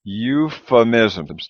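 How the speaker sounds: euphemisms drawn out very slowly, each syllable apart. Shown as a demonstration. Teacher models the word slowly for the class. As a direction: break euphemisms into slow even beats. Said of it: "like an F".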